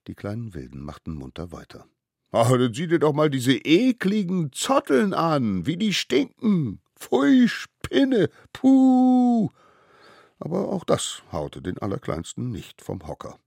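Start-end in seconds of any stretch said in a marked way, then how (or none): 2.3-9.5 whining revulsion, climbing very high; exaggerated high-pitched yuck; mock disgust. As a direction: recoil from a stink, stretching the cries. Gang mocks his stinking shaggy hair.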